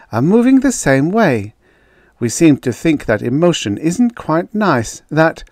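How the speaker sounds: high, keen scientist making her case; earnest woman researcher. Acted. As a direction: put your case to sceptics.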